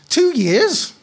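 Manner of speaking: high, sharp outraged cry; shocked indignant squeak. Acted. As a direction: burst out in disbelief, barely held in. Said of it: "kept it down to an outraged".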